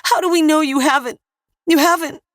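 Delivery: very high, loud shriek; panicked mother's scream; hysterical scream. A narrator's reading. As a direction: scream it on the edge of breaking. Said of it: "A hysterical woman screamed".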